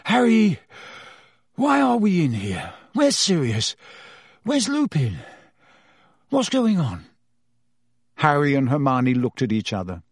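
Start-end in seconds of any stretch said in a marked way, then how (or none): 0.1-7.1 put-on higher, bewildered boy; lightly voiced confused Ron. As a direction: wake up muddled.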